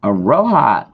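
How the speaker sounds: Aloha in a put-on accent, L turned toward R; loud, playful accented "Aloha". Streamer greeting a viewer, putting on an accent. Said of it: "did that with that accent".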